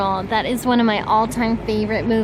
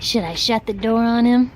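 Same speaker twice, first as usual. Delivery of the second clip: ordinary talk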